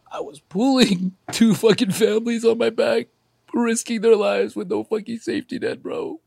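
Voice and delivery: pushing the words out through real tears; crying. Real, not acted. Telling his podcast co-host about carrying his families, crying. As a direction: voice breaking with tears, a choked laugh on pulling. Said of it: "that's why I'm crying".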